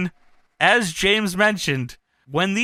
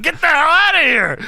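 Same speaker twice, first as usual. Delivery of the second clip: much higher, louder order out; mock shout; 11 semitones higher, 6 dB louder than usual. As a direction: throw him out. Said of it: "Kev said".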